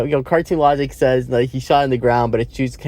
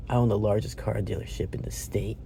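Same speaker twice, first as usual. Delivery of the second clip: bragging; 3 semitones lower, 10 dB quieter than usual. Retold or imitated. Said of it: "That's his bragging".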